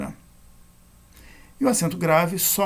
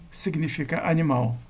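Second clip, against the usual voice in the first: ordinary talk, nothing marked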